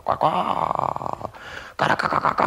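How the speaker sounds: whispered cawing squawks; jungle bird calls. Retold or imitated. Night on the riverboat, whispered jungle calls. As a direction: breathe out jungle bird noises. Imitating mysterious jungle noises.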